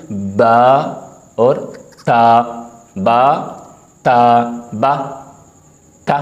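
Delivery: ba and ta said slowly, each sound clear; letter drill. Shown as a demonstration. Teacher sounding out बा and ता for Quran students. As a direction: sound out बा and ता one by one. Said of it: "अलबत्ता आप सुन लें".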